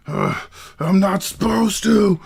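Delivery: high loud panicked whine; freaking out giant. Acted. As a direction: wail it like a panicking brute. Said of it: "He starts, like, freaking out".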